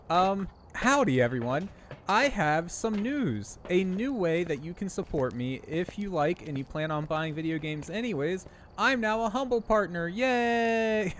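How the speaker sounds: put-on chirpy anime girl ad read; cute anime streamer. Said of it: "my anime girl voice".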